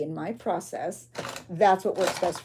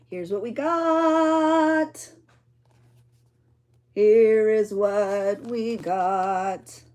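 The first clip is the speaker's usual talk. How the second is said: grand reveal; 6 dB louder, much slower than usual. Shown as a demonstration. Crafter unveiling her finished journal page, drawn-out grand reveal.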